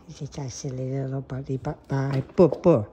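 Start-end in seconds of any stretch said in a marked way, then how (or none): 2.3-2.9 loud playful boo. Real, not acted. Owner playfully booing at her cat, loud. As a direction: pop it out to startle him.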